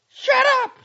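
very high, loud yell; angry cry. A narrator's reading. Girl silencing the doctor, angry cry. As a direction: cut him off with a yell. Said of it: "she cried".

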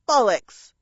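loud one-word jeer. A narrator's reading. Second crow to the parrot, loud jeer. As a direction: jeer it loudly. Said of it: "a second one jeered".

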